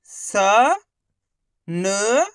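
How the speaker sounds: स and न each held alone with a gap between; letter by letter. Shown as a demonstration. Teacher demonstrating one letter at a time, syllables isolated and held. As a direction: sound out स, pause, then न, stretching each short vowel. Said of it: "एक अक्षर का उच्चारण ही तो करना है".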